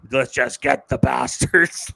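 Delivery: slow, laughing mock of a stern lady; host quoting the professor's one-liner, lightly mocking. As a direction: imitate her flat line through a laugh. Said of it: "a story you telling me, hilarious".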